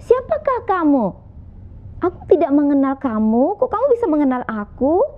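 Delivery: put-on high, insistent young man calling out; young man demanding. Young man calls to the unseen voice, high and insistent. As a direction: demand it high, as if searching the dark.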